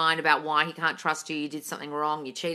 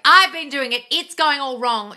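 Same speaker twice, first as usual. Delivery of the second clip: loud, high-pitched mock complaint; exaggerated frustrated follower; mock complaining; 9 semitones higher, 11 dB louder, slower than usual. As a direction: imitate a follower wailing that it all fails. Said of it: "You come on here a lot and say".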